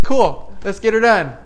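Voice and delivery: rally him like a teammate; upbeat cheer. Retold or imitated. Agreeing with the painter to get the job done, loud upbeat cheer. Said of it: "I'm like".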